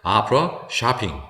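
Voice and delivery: slow American model words, o said as 아; American pronunciation. Shown as a demonstration. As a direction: say them slowly in American English, open 'ah' vowels. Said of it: "미국식 발음으로는 아로 발음합니다". (미국식으로 천천히, o를 아로)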